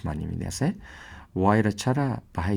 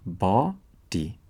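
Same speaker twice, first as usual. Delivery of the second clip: slow syllable split; much slower than usual. Shown as a demonstration.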